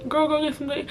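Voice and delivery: high, soft mock family voice; pushy relative. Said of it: "my family pointing it out and being like".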